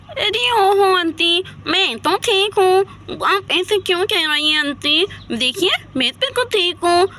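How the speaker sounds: put-on babyish voice with lisped consonants; talking like a child. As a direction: prattle and plead like a little kid. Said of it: "ये तो बिल्कुल बच्चों की तरह बोल रही है". (बच्चों की तरह तुतलाकर बोलते हुए)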